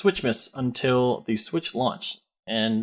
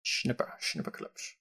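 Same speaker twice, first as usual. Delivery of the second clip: whispered; 2 semitones lower, 15 dB quieter, slower than usual. Pretend. Whispered title again.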